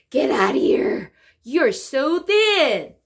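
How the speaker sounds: loud, slow "Get out of here. You're so thin."; loud slow incredulous reading; exclaimed disbelief. Shown as a demonstration. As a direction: read it like a stunned friend. Reading final dialogue lines.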